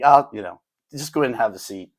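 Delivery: usual speaking voice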